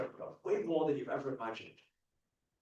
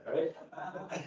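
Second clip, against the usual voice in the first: ordinary talk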